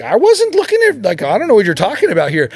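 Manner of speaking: starts high and loud, then rushes on; mock indignant denial. Husband backpedaling as fast as he can, in mock indignant denial. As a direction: protest innocence in a hammed-up scramble. Acted denial of interest in her.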